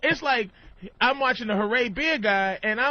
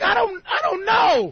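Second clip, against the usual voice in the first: loud high unsure; 10 semitones higher, 4 dB louder than usual. Retold or imitated. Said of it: "I'm like".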